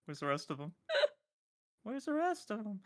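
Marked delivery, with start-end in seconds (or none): 1.8-2.8 high cartoon-voice question; very high-pitched put-on voice; SpongeBob. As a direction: squeak it out like a puzzled cartoon. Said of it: "I'm gonna pull a SpongeBob".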